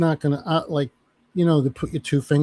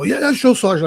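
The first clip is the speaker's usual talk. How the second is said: recruit answering; 7 semitones higher, 5 dB louder than usual. Retold or imitated. Recruit answering drill sergeant.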